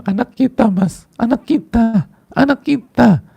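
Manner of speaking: high, loud crying plea to the husband; sobbing high and loud, breaking down; tearful wail. Acted. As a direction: wail it like a mother who just lost her child. Wife sobbing out the news.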